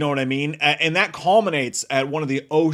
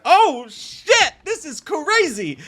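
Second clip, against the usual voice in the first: much higher, amazed outcry; stunned reaction; 14 semitones higher, 5 dB louder, much slower than usual. Retold or imitated. Viewer reacting as she falls into the table, high stunned outcry. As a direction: let the shock burst out of you. His shocked reaction at the film.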